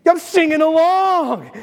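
slow, stretched-out gleeful exclaiming; hammed-up eager fan joining in; thrilled recognition. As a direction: drag out each phrase in acted delight. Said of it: "when they start singing, you're like".

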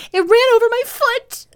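put-on very high, loud frightened child; shrieking. A narrator's reading. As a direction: squeal it out in horror. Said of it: "shrieked the child".

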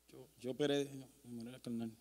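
very soft, low mumbled confession; hushed confession. Real, not acted. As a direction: let it trail almost to nothing. Reluctant, hushed confession, then repeated aloud. (confesión entre dientes, muy bajita)

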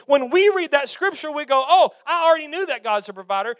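loud, driving pulpit preaching; full-force preaching. Acted. Pastor preaching hard to the church, loud and driving. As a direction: preach it out to the congregation with fire.

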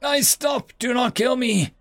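high, desperate scream. Acted. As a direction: scream out in panic to surrender. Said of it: "he raised his hands and screamed".

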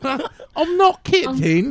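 high, slow mock British; drawn-out British nanny voice; hammed-up British accent with a laugh; Mary Poppins. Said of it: "that was my Mary, Mary Poppins".

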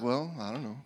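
low, soft noncommittal shrug; mock indifference. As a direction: imitate someone passively giving up. Mimicking a passive, unsure response.

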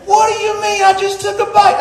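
high-pitched mock indignation; upset wife. Preacher imitating his wife upset over a bite, mock indignant. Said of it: "She gets so upset".

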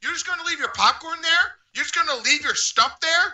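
high, rising accusing questions; high-pitched mock indignation; imitated indignant staffer. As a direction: imitate someone scolding a customer. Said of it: "He's like".

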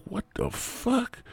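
high, slow, drawn-out what the fuck; stunned disbelief. Retold or imitated. Player stunned by the kid's castle, high and slow. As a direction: stretch it out in shock. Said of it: "And I'm like".

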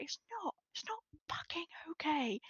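high start sinking into a whisper; retold whisper. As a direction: breathe it out as a half-whisper through the grief. Retelling her grief-stricken roleplay breakdown.

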